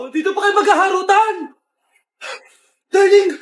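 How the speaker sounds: loud tearful melodramatic yelling; teleserye confrontation. Acted. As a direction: scream it like a soap opera. Wife catches husband with friend.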